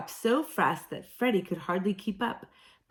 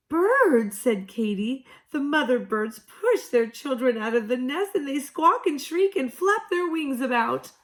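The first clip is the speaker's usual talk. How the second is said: high, loud, wailing mother kangaroo; agitated; 5 semitones higher, 5 dB louder than usual. A narrator's reading. Katy to Freddie, loud and upset. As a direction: blurt it out higher and louder.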